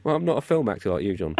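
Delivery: ordinary talk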